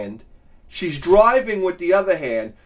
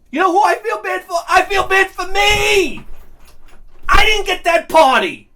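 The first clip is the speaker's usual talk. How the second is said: high, loud self-pitying outburst; indignant yelling; 11 semitones higher, 4 dB louder than usual. Acted. Answering his wife who says feel bad, indignant yell. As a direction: yell it in comic outrage.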